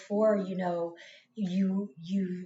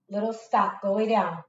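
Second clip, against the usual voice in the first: calling out sharply; 2 semitones higher, 9 dB louder than usual. Real, not acted. Scolding someone off camera.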